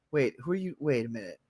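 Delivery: usual speaking voice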